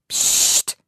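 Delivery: sharp unvoiced shushing hiss; mother hushing the girls toward the bathroom; loud hush. Lautes Zischen zum Schweigen. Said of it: "macht Mama".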